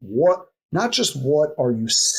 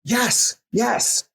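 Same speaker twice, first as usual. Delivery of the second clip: eager agreement; 8 semitones higher, slower than usual. Retold or imitated. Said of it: "or it's like".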